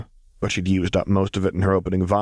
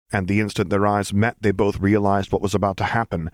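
plain reading voice

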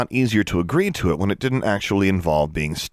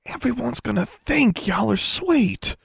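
put-on much higher, slow Ozark girl's voice; whispered Southern tease; slower than usual. A narrator's reading. Forbearance leans across and whispers in a slow Southern drawl. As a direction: drawl it slowly, leaning in close. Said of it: "leaned across the table and whispered".

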